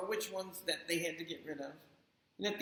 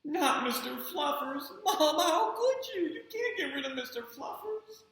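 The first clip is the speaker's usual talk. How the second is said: put-on whiny child; 9 semitones higher than usual. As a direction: beg like a kid losing his bunny. Spencer pleads over his bunny.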